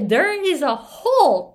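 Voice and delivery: slow, loud "hole" with the "ah" vowel of hall; retold mispronounced "hole", slow and laughing. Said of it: "って言うんですよ、私が".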